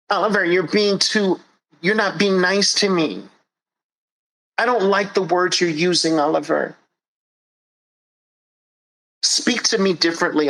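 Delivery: mock hurt plea, high and whiny; whiny complaint. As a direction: whine like a fragile complainer. Soft people complaining to Oliver.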